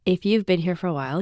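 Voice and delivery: normal talking voice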